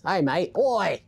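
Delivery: light mock Aussie-British greeting; a hint of hammed-up Australian; UK accent gone Australian. Shown as a demonstration. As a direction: attempt British, land in Australian. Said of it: "my U K accent is Australian today".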